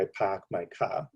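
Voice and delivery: Boston dropped R's, "parked" as "pahked", "car" as "cah"; Boston accent. Shown as a demonstration. Lecturer showing an audience how A's shift, Boston accent. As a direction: say it with a non-rhotic Boston accent, flattened A's. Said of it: "in Boston, you'd say".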